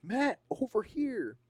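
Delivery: high, drawn-out call. Acted. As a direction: call someone over from another room. Friend calls out in a story.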